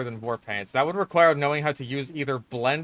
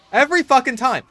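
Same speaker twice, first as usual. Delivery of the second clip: high, loud furious yell; yelling "Every fucking time!" in real frustration; frustrated shout; 12 semitones higher, 8 dB louder than usual. Real, not acted. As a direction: explode with real rage at the game. Spy killed again by pyro.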